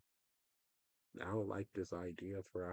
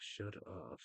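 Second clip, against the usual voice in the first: breathy whispered exasperation; whispered shut up; 8 dB quieter than usual. Real, not acted. Fed up with doom talk, whispering to himself. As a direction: hush it out under the breath, fed up. Annoyed at video game crash talk.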